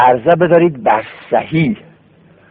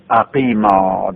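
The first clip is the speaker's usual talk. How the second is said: slow model word; 5 semitones lower, much slower than usual. Shown as a demonstration.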